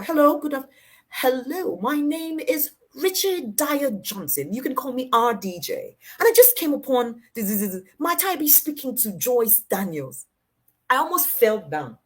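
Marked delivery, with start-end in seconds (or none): 0.0-10.3 lightly voiced slow, formal, high gentleman on the phone; a hint of a courtly old caller; RDJ's voice. The mentor phones her for the first time, lightly mimicked formal slow voice. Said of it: "you know that that's how he talks".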